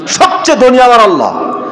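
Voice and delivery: high, ringing declaration; impassioned preacher's cry; fervent proclamation. Real, not acted.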